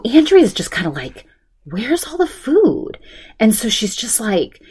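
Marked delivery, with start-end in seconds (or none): 1.6-3.0 high, slow puzzled guest voice; a touch of the lost party guest; imitating Andrea. Friend retelling Andrea's confusion, high slow puzzled voice.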